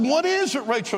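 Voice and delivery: high-pitched eager pleading; urgent repeated question, jumping up in pitch; retold urgent questioning. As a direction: reenact pressing a wife for news. Acts out pressing wife for news.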